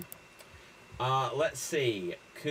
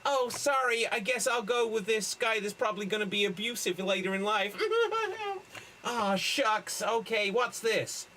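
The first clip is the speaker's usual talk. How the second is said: lightly voiced boy, pitched up; let-down kid in a skit; 7 semitones higher than usual. Girl leaves the boy at the ride, mock disappointment. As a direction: play a hurried, mildly put-out kid. Acts out a film remake.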